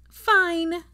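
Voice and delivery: a hammed-up sulky boy. Teddy giving in to grandmother, high sulky child. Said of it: "mumbled Teddy sulkily".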